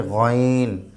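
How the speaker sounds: গইন from the far end of the throat, drawn out; throat-end sound. Shown as a demonstration. Teacher shows where the letter comes from, throat-end sound. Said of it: "কণ্ঠনালীর শেষ থেকে".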